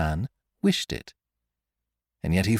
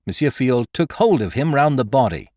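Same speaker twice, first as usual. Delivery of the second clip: plain reading voice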